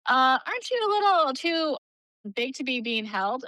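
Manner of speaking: high, pointed mock question; imitated stewardess. Stewardess questioning a mom carrying her child, imitated high and pointed. Retelling a stewardess's nosy comment.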